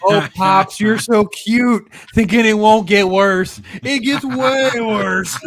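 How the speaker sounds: mock-sweet gloating at a friend; imitating Loki. Loki teasing Pops, drawn out and gleeful. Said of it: "was Loki laughing".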